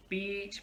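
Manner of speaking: "beach" with a lengthened ee; long vowel. Shown as a demonstration. Teacher explains two vowels together, long vowel. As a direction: draw out the ee in "beach". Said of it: "gonna make the sound longer".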